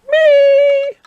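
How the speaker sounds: very high, loud little voice; eager child. Acted. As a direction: squeak it eagerly like a cub. Eager answer.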